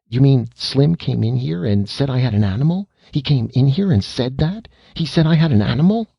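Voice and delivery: put-on indignant boy, pitch climbing to a squeak. Boy outraged his friend told on him, indignant and rising. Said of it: "recovered indignant breath".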